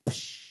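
long hissing "psh" of shattering glass; drawn-out shattering "psh"; imitated smashing sound. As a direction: make the noise of a bursting tube. Imitates light tube smashing.

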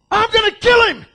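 hammed-up furious bellow; mock angry God. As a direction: play an enraged God about to strike. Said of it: "God would go".

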